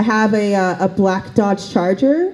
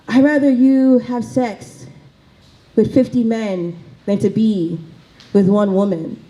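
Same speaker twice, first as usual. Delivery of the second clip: put-on Haitian accent. Haitian mother telling her daughter her preference, Haitian accent. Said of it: "do the accent".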